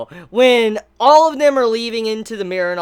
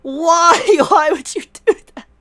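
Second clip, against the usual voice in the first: hammed-up aghast reaction, pitched up; mock dismay; 10 semitones higher, 4 dB louder than usual. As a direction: plead in disbelief while breaking into laughter. Retells Capone's dismayed reaction while laughing.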